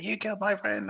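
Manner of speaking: high-pitched, lightly acted accusation; mock accusation. Film fan voicing a man taking revenge, mock accusation. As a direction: imitate an avenging movie character, not in full earnest.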